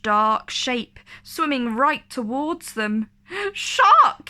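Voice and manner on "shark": high, loud alarmed cry; sudden scream of alarm; yelled. Acted. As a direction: shout the warning at full pitch. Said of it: "he yelled".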